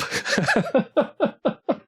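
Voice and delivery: high, breathy run of giggles; high-pitched tittering laugh; real giggle. Real, not acted. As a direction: giggle helplessly at the joke. Player amused by the line.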